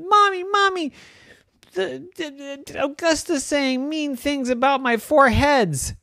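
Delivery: high, breathless whine; tattling kid. Acted. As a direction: run to mom to complain. Brother tattling to mom.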